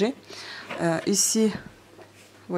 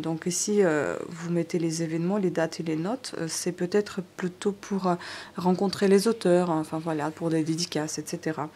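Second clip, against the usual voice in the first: usual speaking voice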